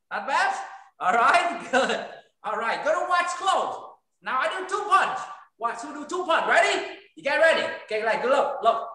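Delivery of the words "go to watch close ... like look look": ordinary talk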